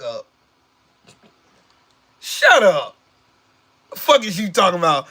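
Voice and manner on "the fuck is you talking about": normal talking voice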